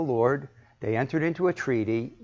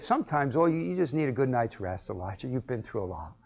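tender father; 6 dB quieter than usual. Retold or imitated. God comforting exhausted Elijah, tender and soft. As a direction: soothe a worn-out child. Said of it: "he said".

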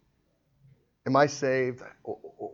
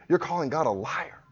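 ordinary talk

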